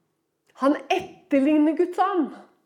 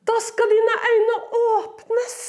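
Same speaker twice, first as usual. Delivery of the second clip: mocking Satan; 8 semitones higher, 5 dB louder, slower than usual. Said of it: "så grusomt hvordan han er".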